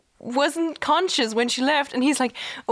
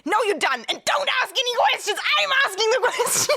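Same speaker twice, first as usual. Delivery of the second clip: bossy; 10 semitones higher, faster than usual. Retold or imitated. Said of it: "yanks her hand away, and is like".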